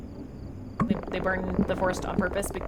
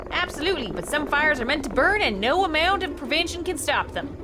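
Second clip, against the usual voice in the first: lightly voiced ominous stranger; a hint of a creepy worker, pitched up a little; Silas; 7 semitones higher, 4 dB louder than usual. Stranger talks of fires meant to burn, lightly ominous.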